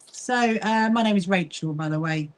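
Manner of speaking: ordinary talk, nothing marked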